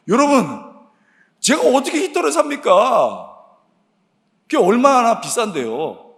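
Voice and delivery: high, loud exasperated cry; exclaiming protest. Real, not acted. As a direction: throw the protest out to the congregation. Protests he can't afford it.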